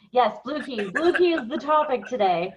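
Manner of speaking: normal talking voice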